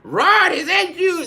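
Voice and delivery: very high, loud; drunk relative. Retold or imitated. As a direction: reprise the tipsy greeting. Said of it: "I was like".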